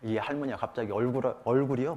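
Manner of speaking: usual speaking voice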